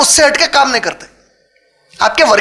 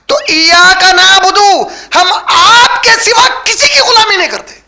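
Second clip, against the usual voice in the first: loud, much higher proclaiming shout; shouted climax; 6 semitones higher, 3 dB louder, slower than usual. Acted. Preacher proclaiming the verse's meaning to listeners, shouting.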